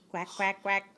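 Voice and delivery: short, low quacks in a row; duck quacking. Shown as a demonstration. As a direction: imitate a duck for a toddler. Answers what the duck says.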